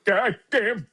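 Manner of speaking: put-on high, drawn-out old-geezer grumble; grumpy old man. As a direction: grouse like an old guy on the porch. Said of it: "I sound like one of those old guys".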